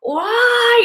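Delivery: high, drawn-out exasperated cry; retold angry outburst. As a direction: wail it in mock outrage. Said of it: "I just got so angry".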